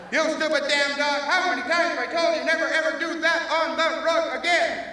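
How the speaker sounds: loud, high angry scolding; furious owner shouting at his dog; yelling at dog. Acted. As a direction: bawl the dog out over the rug.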